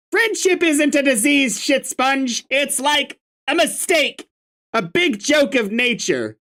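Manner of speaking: sarcastic grumpy troll. Acted. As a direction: spit sarcasm, voice pitched high.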